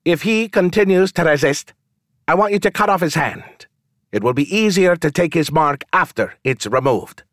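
high, lazy, bored nobleman. A narrator's reading. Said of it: "said the pretty man in a bored voice".

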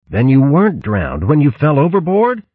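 1 astonished. Acted. Verg stunned at Bo alive.

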